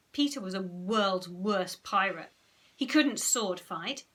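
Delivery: ordinary delivery, nothing marked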